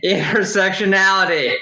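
stifled laugh on the word; fighting back a giggle while reading; trying not to laugh. Real, not acted. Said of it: "Gotta try not to laugh".

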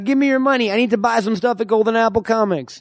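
high-pitched mock stick-up voice; imitating a robber. Host imitates co-host robbing people for comics money, high mock demand. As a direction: put on a squeaky, comic mugger demand. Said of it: "He's like".

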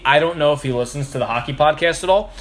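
normal talking voice

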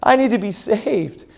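put-on high, teary child's plea; boy waking his father at night, tearful; crying little boy. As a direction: beg like a scared five-year-old. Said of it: "I just started crying".

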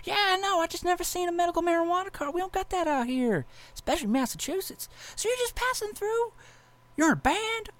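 squeaky disbelieving cop; doing the puzzled cop in a high put-on voice; high-pitched cop voice.

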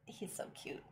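ordinary talk

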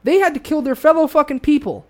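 high, impassioned and really angry; angry rant. Real, not acted. Ranting that the founders fought their own people, angry. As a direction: rant it out, pitch pushed up. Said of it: "it makes me fucking angry".

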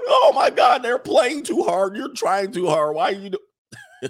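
high, loud mock shriek slowing down. Sisters losing the video game, a hammed-up shriek. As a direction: shriek like you're getting crushed.

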